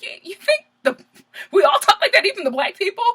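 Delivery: high-pitched disbelief, voice pushed up; incredulous retort. Retold or imitated. Vlogger replying to British friends' fake American accent, incredulous. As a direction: squeal it in mock disbelief.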